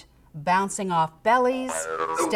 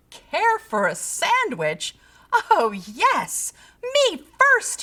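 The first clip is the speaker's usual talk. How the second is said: high, louder greedy squeal; eager piggy thought; 9 semitones higher, 4 dB louder, slower than usual. A narrator's reading. Pig hears about a sandwich, eager. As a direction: build to a delighted squeal.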